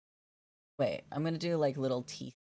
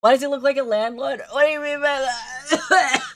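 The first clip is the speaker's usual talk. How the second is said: mock offended; 7 semitones higher, 6 dB louder than usual. Real, not acted. Reading chat, offended.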